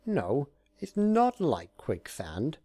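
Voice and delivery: high, slow insistent correction; insisting. Acted. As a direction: drag it out, insisting. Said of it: "he insisted".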